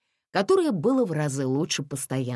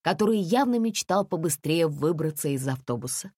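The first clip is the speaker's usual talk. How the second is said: usual speaking voice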